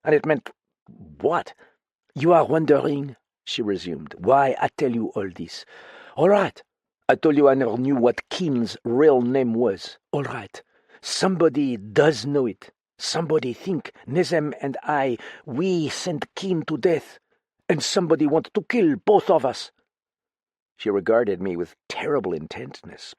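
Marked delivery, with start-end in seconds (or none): 2.1-3.1 put-on higher, foreign-accented woman, fretful; frightened foreign woman confiding in a friend; lightly French-tinged voice, uneasy and pleading; accented woman character. Same character voice. 4.2-19.7 put-on higher, foreign-accented woman, fretful; frightened foreign woman confiding in a friend; lightly French-tinged voice, uneasy and pleading; accented woman character. Same character voice.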